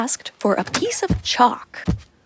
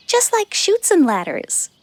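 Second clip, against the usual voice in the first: Lulu explaining; 9 semitones higher than usual. Retold or imitated. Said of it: "says Lulu".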